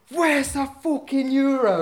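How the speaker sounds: high, slow, loud furious demand; angry demand. Retold or imitated. Anna confronting the thief who stole the wallet, high angry demand. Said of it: "he's really angry".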